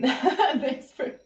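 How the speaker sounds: laughing thanks; giggling thanks to a viewer. Real, not acted. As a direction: laugh as you thank her.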